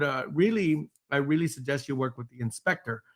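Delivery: ordinary delivery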